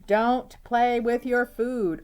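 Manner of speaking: high, loud, slow motherly order; stern mom command. Acted. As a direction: order him slowly like a cross mom. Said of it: "David's mom always said".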